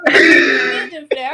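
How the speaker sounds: slow, loud shout of praise. Real, not acted. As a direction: belt it out to hype him up. Hyping up a punctual friend.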